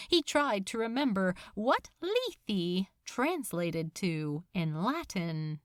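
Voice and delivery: plain reading voice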